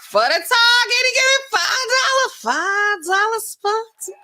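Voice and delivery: very high, loud squealed announcement; excited donation read-out. Real, not acted. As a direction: squeal it out in delight. Excitedly reading out a donation message.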